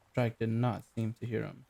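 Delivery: ordinary delivery, nothing marked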